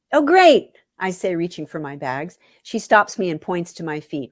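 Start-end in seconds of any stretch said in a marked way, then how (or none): 0.1-0.8 much higher and louder, forced brightness; fake cheer. Lisa forcing cheer at cold Enid, high and loud. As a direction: chirp it with fake enthusiasm.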